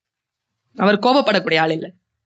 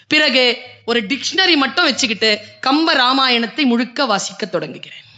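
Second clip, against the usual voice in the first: ordinary delivery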